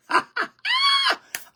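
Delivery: very high, squealing burst of laughter; excited shriek laugh. Real, not acted. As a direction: squeal with joy. Excited laugh after quoting lyric.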